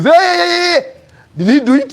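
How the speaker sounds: high, loud wail of shock; aunt's shrieky outcry; acted alarmed aunt. Aunt's alarmed outburst at the news.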